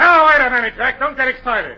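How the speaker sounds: loud, high interruption; agitated attempt to calm him; urgent appeal. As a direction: stop him before he storms out. Director stops actor walking out.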